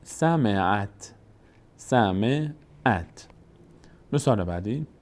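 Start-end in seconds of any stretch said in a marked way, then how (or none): 0.0-3.3 «سمعت» drawn out as sa-mi-at; slow syllabic reading. Shown as a demonstration. Teacher demonstrating joined final ta, slow syllables. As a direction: spell out سمعت slowly, closing on "at". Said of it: "باید فتحه رو بچسبونیم به ت".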